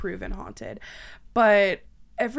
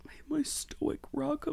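breathy whispered swoon, higher and softer; dreamy mock-romantic whisper; 6 semitones higher, 9 dB quieter, slower than usual. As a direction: whisper it like a lovesick fan. Mock-swooning over husband.

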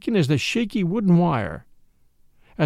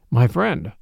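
a touch of grave seriousness, lower pitched; equally serious; 5 semitones lower than usual. A narrator's reading. Priest answering his friend Flambeau, serious. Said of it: "with equal seriousness".

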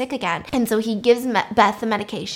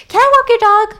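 high, loud blurted request; hammed-up childlike plea; eager girl; 13 semitones higher, 11 dB louder than usual. Excited girl asks neighbour.

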